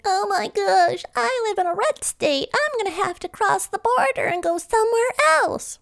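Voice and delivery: high nasal-free whine, mocking a protester; whiny mock voice. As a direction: mimic a whining complainer. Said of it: "before you go whining and complaining".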